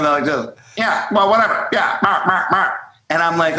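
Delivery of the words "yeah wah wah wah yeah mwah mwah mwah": slightly higher squawky mimicry; short repeated quacks in imitation; Penguin. Mocking a bandmate's playing, light Penguin squawks. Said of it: "like, the penguin from Batman".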